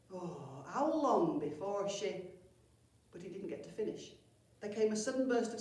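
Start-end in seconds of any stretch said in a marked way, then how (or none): 0.1-2.3 slow, defeated sigh; weary groan. A narrator's reading. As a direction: sag with despair. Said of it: "slumped back with a groan".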